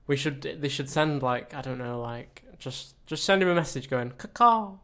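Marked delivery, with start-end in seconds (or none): 4.1-4.7 high cawing bird cry; crow call. Pretend. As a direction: caw like a crow. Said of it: "send a bird signal".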